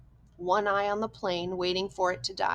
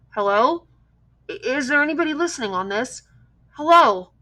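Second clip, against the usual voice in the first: loud, anxious radio call, higher; boy calling into a radio mic, hoping someone hears; calling hello; 5 semitones higher, 9 dB louder than usual. A narrator's reading. Boy alone in plane calls into the mic, loud and anxious. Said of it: "He repeated it".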